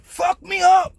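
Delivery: high, drawn-out cry of disbelief; retold shock. Storyteller reliving her sudden jump back, high shocked outcry. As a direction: act out being stunned, pitch way up.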